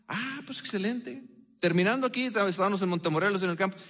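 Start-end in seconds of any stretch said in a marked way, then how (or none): 0.1-1.2 high, soft "ah" of delight; imitated pleased reaction. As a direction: act out pleasant surprise, softly. Retells his pleased reply to the plan.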